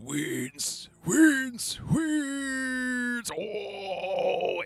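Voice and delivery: high, drawn-out wailing cry, growing with each word; hammed-up summoning howl. Crying Dracula summons wind to the candles, theatrical howl. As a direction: wail it like calling up a storm.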